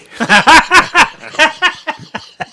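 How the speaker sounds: high loud burst of laughter. Real, not acted. Recalling his revenge plan, loud laugh. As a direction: crack up loudly. Laughs after own line.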